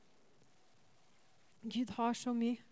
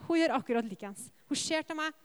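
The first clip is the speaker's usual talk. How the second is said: normal talking voice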